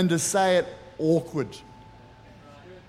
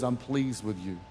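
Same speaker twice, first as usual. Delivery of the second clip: much lower and softer, almost hushed; soft blessing; 8 semitones lower, 8 dB quieter than usual. Shown as a demonstration. Preacher offering the alternate blessing, hushed and tender. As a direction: let it fall quietly and gently. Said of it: "another version says".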